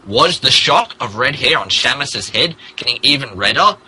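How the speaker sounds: usual speaking voice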